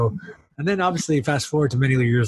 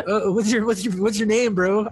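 a higher, laughing bro asking a name; mock dude voice; 8 semitones higher than usual. Podcast host mocks a fellow vampire meeting his cohost, higher and laughing. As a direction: toss off a jokey high "what's your name, bro".

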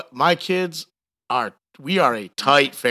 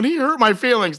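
high-pitched mock hurt complaint; 8 semitones higher than usual. Voicing workers upset by the boss, mock hurt.

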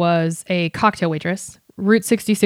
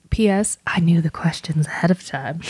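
whispering. Real, not acted. Joking aside to listeners.

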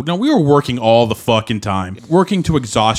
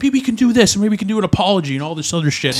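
higher-pitched retelling of frantic ideas; retold panicked spouting; 8 semitones higher than usual. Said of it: "I'm sitting there spouting".

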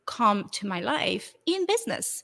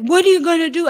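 surprised cabbie; 7 semitones higher, 9 dB louder, faster than usual. Retold or imitated. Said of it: "And he goes".

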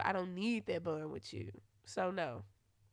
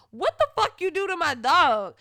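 loud, higher retold yell; angry yell; 7 semitones higher, 11 dB louder than usual. Waking her man to yell about her dog, furious. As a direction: bark it at him like he hurt your dog. Retelling yelling at boyfriend.

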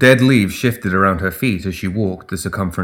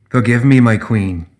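ordinary delivery

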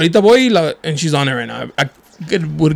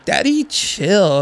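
slow, drawn-out, higher-pitched imitation; slow mock calming call; imitating background dude; 4 semitones higher, much slower than usual. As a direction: mimic the bystander, stretching both words. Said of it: "this dude in the background goes".

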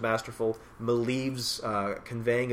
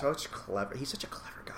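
whisper; 2 semitones higher, 8 dB quieter than usual. Real, not acted. Admiring aside while reading comic.

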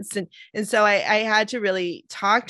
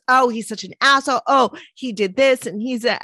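louder, higher, quick mocking rant; man-hater's rant; 5 semitones higher, 5 dB louder than usual. A man-hater friend trashing the boyfriend, mock rant. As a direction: imitate a bitter man-hater venting. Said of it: "if I told someone who hated men about this".

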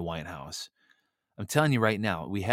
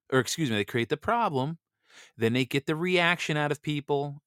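normal talking voice

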